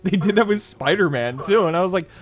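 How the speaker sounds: normal talking voice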